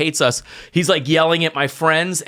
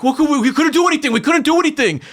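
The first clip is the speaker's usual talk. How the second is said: high, rushed defensive protest; defensive mock whine; imitated excuse-making; 11 semitones higher, faster than usual. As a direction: mimic friends pleading helplessness, pitch way up.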